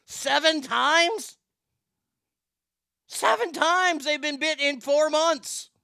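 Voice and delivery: high, slow disbelief; incredulous. Real, not acted. The host marvels at the bite count, high, slow and incredulous. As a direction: drag it out in shock.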